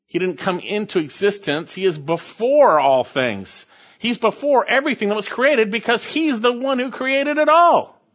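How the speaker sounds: preacher driving home the point; fervent preaching. Acted. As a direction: build it up to a ringing peak. Preaching climax on eternity.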